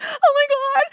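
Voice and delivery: high, loud mock panic; panicked exclamation. Retelling airport panic at the check-in counter, panicked squeal. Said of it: "I was like".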